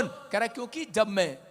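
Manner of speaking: ordinary delivery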